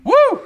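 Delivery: high, loud "woo" of celebration; excited whoop. Real, not acted. Streamer celebrating the follow goal, excited whoop.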